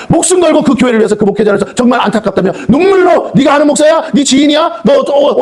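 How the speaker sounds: high, shouting rebuke from the pulpit; full-force preaching. Acted. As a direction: thunder at the congregation, pitch climbing. (높은 목소리로 외치는 설교)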